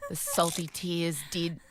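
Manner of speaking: ordinary talk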